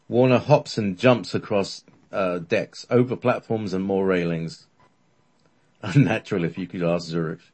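plain reading voice

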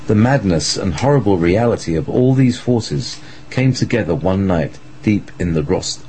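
plain reading voice

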